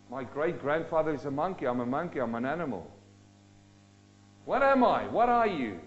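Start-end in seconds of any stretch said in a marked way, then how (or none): none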